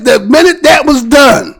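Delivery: ordinary talk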